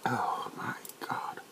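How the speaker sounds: shocked whispered aside; horrified whisper. Acted. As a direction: breathe it out in disbelief.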